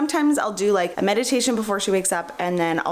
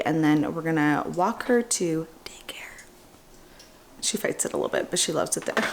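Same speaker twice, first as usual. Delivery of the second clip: hushed, secretive whisper; 4 semitones lower than usual. Real, not acted. Sneaking upstairs aside.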